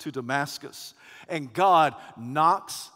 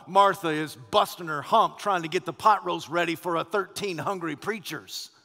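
ordinary delivery

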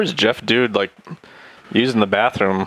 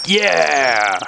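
triumphant yell; 7 semitones higher than usual. Pretend. Triumphant cry on landing.

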